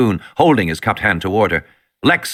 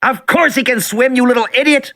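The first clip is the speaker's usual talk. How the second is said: put-on boy shouting; 14 semitones higher than usual. A narrator's reading. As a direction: shout down at your little sister. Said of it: "Tim shouted at her".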